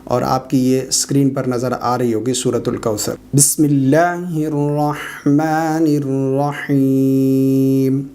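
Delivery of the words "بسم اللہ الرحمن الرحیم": long drawn-out chanted recitation; slow Quran recitation. Shown as a demonstration. Teacher recites the surah for students, slow and chanted. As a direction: recite slowly, stretching every vowel.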